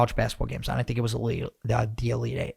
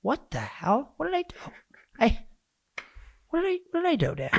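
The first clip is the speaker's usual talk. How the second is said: high, mock-bewildered whine; bewildered protest; 13 semitones higher, 5 dB quieter than usual. Son protests his dad's sudden anger, high and bewildered. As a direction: play the confused son who's lost. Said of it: "I'm like".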